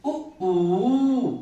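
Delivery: उ clipped, ऊ held long and loud; short and long u. Shown as a demonstration. Teacher showing pupils the उ–ऊ difference, long ऊ. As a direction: stretch the ऊ, keep the उ short. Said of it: "दोनों में अंतर है".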